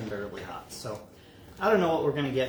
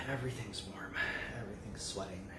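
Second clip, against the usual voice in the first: whispering; 6 semitones lower, 14 dB quieter than usual. Real, not acted. Overwhelmed by heat.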